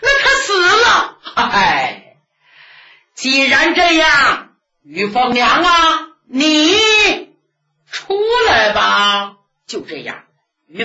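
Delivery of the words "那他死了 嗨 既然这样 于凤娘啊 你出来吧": loud tearful call, drawn out at the end; old nun calling out. Acted. Old nun calling Feng Niang out of the cave, loud and slow.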